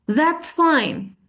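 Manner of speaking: high start, falling, falsely agreeable; fine but not fine. Shown as a demonstration. Teacher showing passive-aggressive phrase, fine but not fine. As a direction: say it's fine while meaning it isn't. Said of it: "تمام، بس مش تمام".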